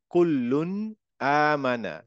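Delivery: kullun amana, double l held, nasal ghunna; Quranic recitation. Shown as a demonstration. Teacher demonstrating Quran recitation to students. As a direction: recite কুল্লুন আমানা slowly with held ll. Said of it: "same ভাবে এখানে".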